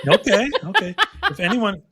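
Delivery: talking through giggles; laughing. Real, not acted. Cracking up after the pour.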